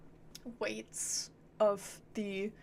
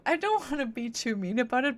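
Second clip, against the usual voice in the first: ordinary talk, nothing marked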